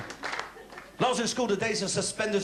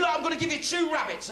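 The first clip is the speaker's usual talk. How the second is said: teacher voice; 8 semitones higher than usual. Retold or imitated. Teacher voiced in stand-up bit.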